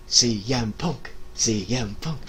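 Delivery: ordinary talk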